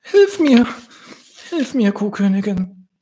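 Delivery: very high, pleading little bird voice; squeaky high dove begging for help. Acted. Injured dove begs for help.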